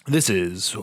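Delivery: low, smooth radio announcer. Shown as a demonstration. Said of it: "You have a decent radio voice".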